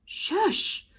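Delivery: very high, sharp shush; high hiss shush. A narrator's reading. Ned silences Slime again with a high shush. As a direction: cut him off with a hiss.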